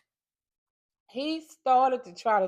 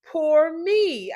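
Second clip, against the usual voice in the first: higher, louder mock wail; self-pitying lament; 7 semitones higher, 8 dB louder than usual. Imagining herself forgotten like the sad girl, self-pitying. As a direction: act out a forlorn child's lament. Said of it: "I'll be somewhere saying".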